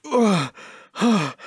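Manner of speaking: ordinary talk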